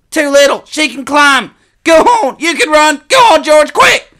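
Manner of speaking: very high, frantic shout; desperate plea. A narrator's reading. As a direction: beg him to leave you and run. Hurt boy begs brother to run.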